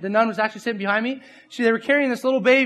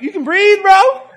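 high, loud teasing concern; mock alarm; 10 semitones higher, 8 dB louder than usual. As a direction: urge a breathless friend, voice pushed up high. Mock reaction to breathless laugher.

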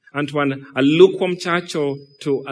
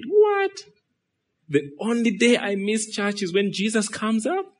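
high-pitched, incredulous "what", then raised-pitch protest; mock disbelief, pitched up; imitated boy's dismay; 6 semitones higher than usual. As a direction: play a child who just missed out. Little boy's reaction in anecdote.

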